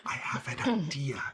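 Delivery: hushed, voiceless whisper; conspiratorial whisper. Pretend. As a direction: whisper it like a secret plan. Conspiratorial whisper, then repeated for mic.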